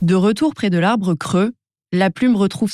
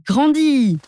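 much higher, bursting peak; big climax; 8 semitones higher than usual. A narrator's reading. Narrator tells the feather growing, high bursting finish. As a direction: make it burst out huge.

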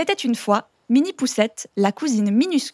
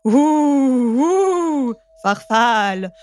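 hint of a hooting ghost, louder; ghostly deep voice; 2 semitones higher, 3 dB louder, much slower than usual. Smallest ghost scares the ogre in a mock ghost voice. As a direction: moan it like a little ghost. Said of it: "dit d'une voix grave".

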